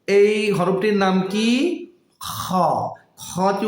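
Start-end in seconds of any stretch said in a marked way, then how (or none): 2.2-2.5 "খ" scraped from the end of the throat, softer than the talk; back-of-throat kha. Shown as a demonstration. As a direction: open "খ" with a long throat fricative. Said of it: "হলকের শেষ ভাগ হতে". ("খ" গলার শেষ ভাগ থেকে ঘষে উচ্চারণ)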